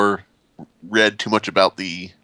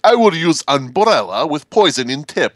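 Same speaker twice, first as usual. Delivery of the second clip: higher-pitched mock Russian; bad Russian accent; 4 semitones higher, 3 dB louder than usual. Putin making up his own game rules, bad Russian accent. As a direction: imitate a scheming Russian player. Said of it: "very bad attempt at a Russian accent".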